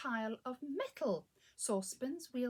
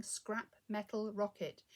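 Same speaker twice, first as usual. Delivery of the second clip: usual speaking voice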